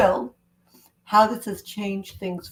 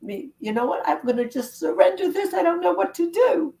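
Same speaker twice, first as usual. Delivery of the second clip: helpless surrender; 9 semitones higher than usual. Shown as a demonstration. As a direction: voice climbing much higher to a pleading peak. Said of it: "So I'm gonna say to myself".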